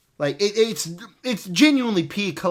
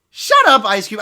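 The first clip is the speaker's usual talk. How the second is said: yelling shut up; 8 dB louder than usual. Real, not acted. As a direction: snap loud at the screen. Reviewer yells at the character.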